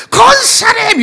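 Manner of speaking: loud, high, drawn-out greeting call; joyful call. Retold or imitated. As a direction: call out to the deaconess across the lot, thrilled. Calling out to deaconess.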